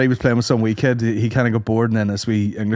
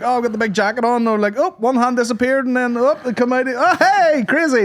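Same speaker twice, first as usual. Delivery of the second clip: high mock clown patter; hammed-up children's clown; 11 semitones higher than usual. Clown doing his act for kids: high mock patter. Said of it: "being like".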